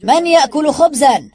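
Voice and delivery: slow loud question to the room; loud call. A narrator's reading. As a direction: address the whole hall slowly and loudly. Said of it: "سألهم بصوت عال".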